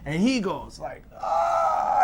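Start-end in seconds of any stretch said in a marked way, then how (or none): 1.2-2.0 high hammed-up yelp of pain; mock pain yelp. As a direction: fake a cry of pain, way up high. Son's mock pain cry.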